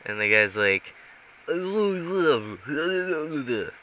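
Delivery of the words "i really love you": put-on slow, high, garbled Russian accent; fake Russian accent. Fake agent answering the baffled pilot, badly faked Russian accent. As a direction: slur it in a hammed-up Russian accent. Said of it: "Fake Russian accent".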